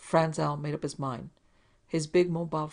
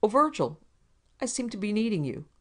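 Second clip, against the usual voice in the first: calling out higher and louder; raised calling voice; 6 semitones higher, 4 dB louder than usual. A narrator's reading. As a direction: call Virgil from across the room. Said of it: "He raised his voice, called softly".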